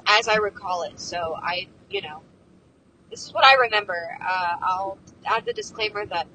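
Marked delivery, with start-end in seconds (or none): none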